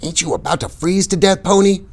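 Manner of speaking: a lightly voiced teenage friend; Johnny voiced. A narrator's reading. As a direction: read Johnny a touch lighter. Johnny's voice in the story.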